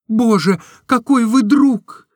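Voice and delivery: high, slow and earnest; earnest Nastenka. A narrator's reading. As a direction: say it seriously, from the heart. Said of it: "очень серьёзно". (серьёзно, высоким голосом)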